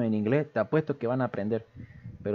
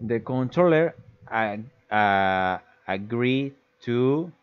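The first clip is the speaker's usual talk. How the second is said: "agree to" drawn out slowly; thinking aloud while modelling the reply; slow, halting answer; much slower than usual. Shown as a demonstration. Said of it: "cómo contestaríamos".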